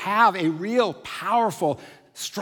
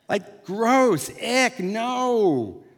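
higher-pitched mock disgust; grossed out; 6 semitones higher, much slower than usual. Kids asked to rub bare feet, playfully revolted. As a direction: recoil with a drawn-out "no".

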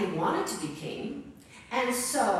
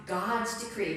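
ordinary talk

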